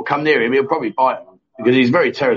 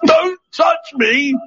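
much higher, very slow shriek of disgust; mock horrified yell; 11 semitones higher, much slower than usual. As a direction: overplay the tough guy recoiling. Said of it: "and they go".

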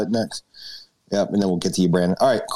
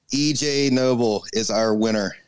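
normal talking voice

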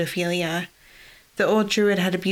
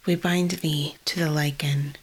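whispered incantation; 2 semitones lower than usual. Acted. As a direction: breathe the spell in a whisper. Chanting a binding spell.

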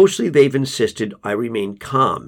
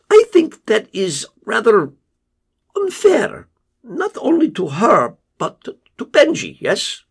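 Casper hedging to the father, higher and level; Casper's voice; 11 semitones higher than usual. Acted. Narrator voices Casper on phone.

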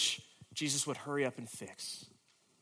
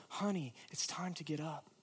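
much softer, gentle wake-up; Jesus gently waking the little girl; tender whisper; 7 dB quieter than usual. Acted. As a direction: soothe her awake like a parent. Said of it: "and whisper".

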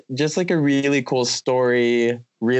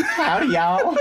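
laughing Southern howdy, pitched higher; mock Southern howdy; 7 semitones higher than usual. Host greeting Blake with a giggly mock Southern howdy. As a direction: greet them like a cartoon cowboy, giggling. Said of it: "Southern greeting to guest".

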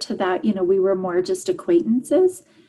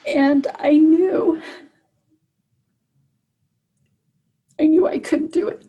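tearful; 8 semitones higher, 3 dB louder than usual. Real, not acted. Mother recalling her frightened children, tearful. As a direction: voice pitched high with real crying. Breaking down retelling the worst moment.

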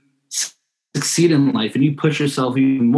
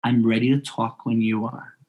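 slightly lowered, softened, unruffled voice; low and calm; 3 semitones lower, 4 dB quieter than usual. Shown as a demonstration. Adult answering a yelling camper, low and calm. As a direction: say it evenly, refusing to rise. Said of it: "just stay as low as you can and say".

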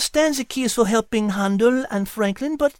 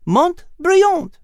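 proud announcement; 3 dB louder than usual. Acted. Liz announcing name.

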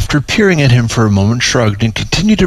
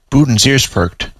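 ordinary delivery

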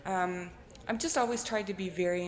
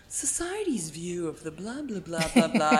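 a lightly voiced pompous essayist; arty pretentious voice. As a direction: send up your own art-student prose. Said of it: "trying to be all arty and fancy".

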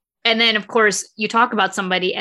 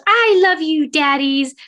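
put-on little girl voice; 5 semitones higher, 3 dB louder, slower than usual. Girl's sweet hug line.

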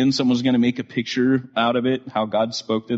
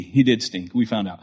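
normal talking voice

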